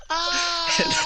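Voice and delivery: high squeal of laughter breaking through; can't finish the word for laughing; real laugh cracking the voice. Real, not acted. Breaks up laughing mid-story.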